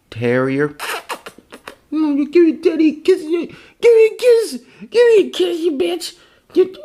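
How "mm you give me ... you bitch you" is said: very high, loud falsetto to a dog. Real, not acted. Owner begging his dog for a kiss, high-pitched baby talk. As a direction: squeal for kisses like a doting owner.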